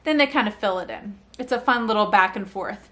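ordinary talk

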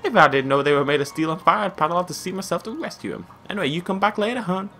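high, badly faked southern US woman; bad southern accent. Fishery owner sends the hunter off, badly faked southern accent. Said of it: "they're, like, really badly southern".

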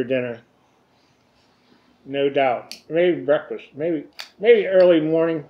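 talking around pipe stem; words through clenched teeth; pipe in mouth. Real, not acted.

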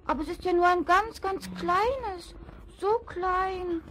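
a greedy cub let down by a tiny piglet; lightly voiced young wolf, a little sulky; disappointed wolf cub. A narrator's reading.

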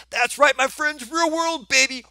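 high, loud showman's announcement; loud, high-pitched celebration; excited hype. Acted. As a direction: hype it up like a big reveal. Hyping the real-world problem.

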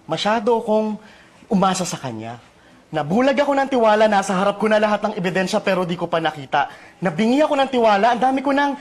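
intense hugot monologue. Acted. Guest performs a sample hugot piece for the host, impassioned and bitter. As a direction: perform it as a heartfelt, bitter spoken-word lament. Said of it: "samples for us of your matinding hugot".

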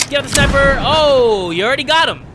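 loud, high gamer hype; loud high-pitched excitement; excited. Real, not acted. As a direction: shout it at the screen. Gameplay excitement.

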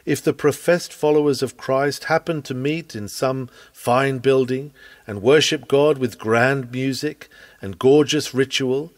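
ordinary talk, nothing marked

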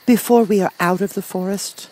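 put-on high-pitched little girl voice; Dorothy, little girl. A narrator's reading. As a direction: ask as a small child, pitched high. Child's question in story.

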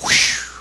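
airy whoosh of parting water; voiceless rushing whoosh; imitated whoosh. God describing the sea opening, imitated whoosh.

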